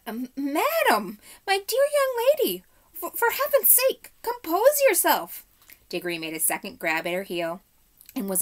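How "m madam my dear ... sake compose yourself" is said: much higher, louder, stammering panic; trembling scream. A narrator's reading. Uncle Andrew begs the witch, high trembling scream. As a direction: squeal it out in terror. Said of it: "in a sort of trembling scream".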